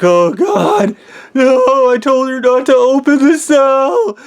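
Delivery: high, loud panicked wailing; over-the-top screechy-high pleading; acted hysterical crying. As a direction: bawl it out in mock anguish, stretching the words. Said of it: "like, bitching and bitching and crying".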